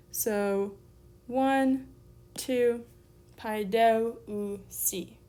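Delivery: slow counted syllables, stress on deo; counting syllables out. Shown as a demonstration. Teacher counting back syllables for the class, slowly. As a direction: count "one, two" then "pai deo u si" slowly. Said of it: "move back two syllables".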